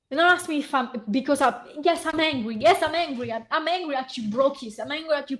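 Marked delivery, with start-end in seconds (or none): none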